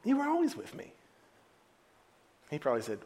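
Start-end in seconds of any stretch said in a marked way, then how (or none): 0.0-0.9 tender father. Acted. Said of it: "the father said".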